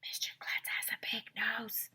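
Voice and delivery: soft unvoiced whisper. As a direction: whisper it in a friend's ear. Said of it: "Alexia whispered in my ear".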